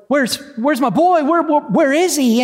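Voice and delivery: acted panic, high-pitched and stumbling over repeats; panicked. Father searching the raft for his missing son, panicked. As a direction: voice a father frantic for his child, pitched well up. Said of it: "In a panic, he's like".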